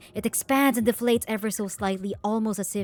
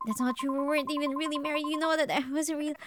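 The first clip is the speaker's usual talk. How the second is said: pained protest; 6 semitones higher, faster than usual. Acted. Acted protest in a quarrel.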